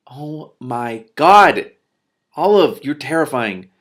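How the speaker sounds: loud, drawn-out gasp of disbelief; loud shocked reaction; shocked exclamation. Real, not acted. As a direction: cry out in amused shock. Reader reacts to Olive.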